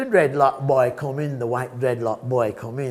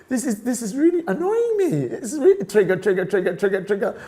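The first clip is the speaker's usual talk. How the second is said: laughing mock annoyance; 7 semitones higher, 4 dB louder than usual. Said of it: "I'm like".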